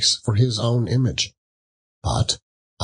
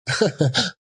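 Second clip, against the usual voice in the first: high cackle; 10 semitones higher than usual. A narrator's reading. Woman cackles.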